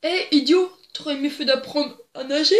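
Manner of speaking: loud, jeering shout at the drowning man; mock shout from the shore. Man on the lake shore shouts at a drowning tourist: loud jeering shout. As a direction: yell it across the water, scornful. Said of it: "il lui crie".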